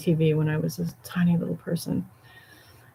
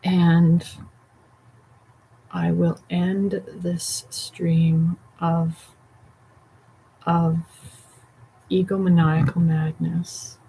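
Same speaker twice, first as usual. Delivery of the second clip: very slow, drawn-out mock-grand ending; slow dramatic sign-off; much slower than usual. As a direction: stretch each word like a grand finale.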